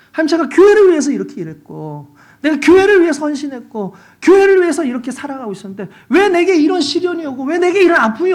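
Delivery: loud, high, pleading protest at God; angry crying out to God. Retold or imitated. Pastor crying out to God alone in the dark church, angry and loud. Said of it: "갑자기 막 화가 나는 거예요".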